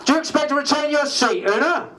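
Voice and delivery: high, eager reporter's question; lightly voiced journalist calling out. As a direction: shout it up from the press pack.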